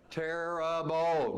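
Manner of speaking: Terrible drawn out slowly, pitched high and loud; emphatic terrible. Shown as a demonstration. Coach telling the audience how bad the boy was, emphatic. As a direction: lean hard on Terrible. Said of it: "Do I need to say that louder?".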